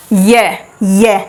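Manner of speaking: "ये" stretched slowly, one at a time; slow, isolated "ये" for learners to copy; drawn-out sound demo. Shown as a demonstration. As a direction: hold each "ये" so the tongue position is felt. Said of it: "जैसे इसमें देख लो".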